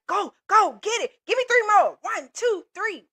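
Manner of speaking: high, loud coach urging reps; pushy coach. Acted. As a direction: bark it like a workout coach. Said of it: "I am that coach that's like".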